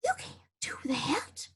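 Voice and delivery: soft, slow whisper of disbelief; shocked whisper. Acted. Drover gasps at Hank quitting, a stunned whisper. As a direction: breathe it out, stunned. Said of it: "Jaw dropped".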